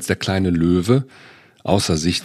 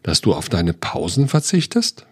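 ordinary delivery, nothing marked

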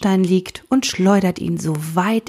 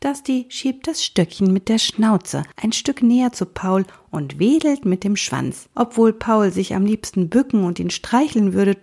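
ordinary delivery

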